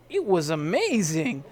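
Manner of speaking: ordinary talk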